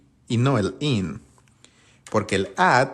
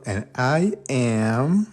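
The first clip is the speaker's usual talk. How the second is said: slow demo; 3 semitones higher, much slower than usual. Shown as a demonstration. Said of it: "El I va con el am".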